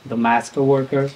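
normal talking voice

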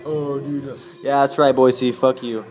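mock hometown jab. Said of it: "No, I'm kidding".

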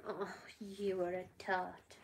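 slow, whispered teasing; soft mock scold in a whisper. Real, not acted. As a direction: breathe it slowly at the dog. Whispered to the dog.

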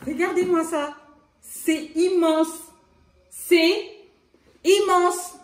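high, loud amazed exclaim; excited. Real, not acted. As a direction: gasp it out, thrilled. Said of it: "Vous allez m'entendre crier".

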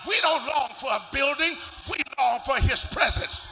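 loud, rising preacher's whoop; full-force preaching. Real, not acted. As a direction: preach it out to the congregation, building.

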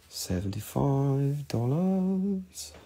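stretched out slowly for emphasis; slow drawn-out. Shown as a demonstration. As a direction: draw every syllable out. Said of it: "and it's seventy-five dollars".

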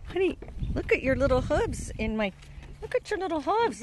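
high, cooing pet voice. Real, not acted. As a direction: fuss adoringly over a little animal. Baby-talk to a pet animal.

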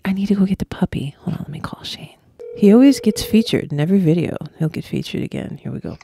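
hushed whisper to the camera; quick unvoiced whisper. Real, not acted. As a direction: whisper like you're sneaking out. Sneaking off to get puppy.

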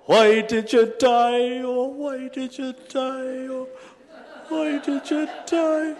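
high, slow keening wail, mock grief; high drawn-out mock lament; mock inconsolable wailing. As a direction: wail it like a grieving widow, hammed-up. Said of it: "she's crying out most inconsolably".

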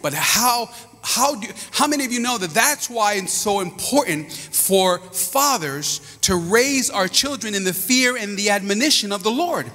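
ordinary talk

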